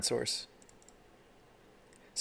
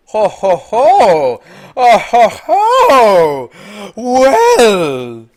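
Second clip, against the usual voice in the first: high, very loud drawn-out hollering; booming, high-pitched hoots; big excited whooping; 11 semitones higher, 15 dB louder, much slower than usual. Real, not acted. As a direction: whoop it out, stretching each sound. Gamer reacting to a big play.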